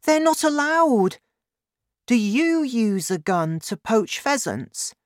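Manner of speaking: put-on high, alarmed child's protest; crying out. A narrator's reading. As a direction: protest as a shocked young boy. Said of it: "I cried".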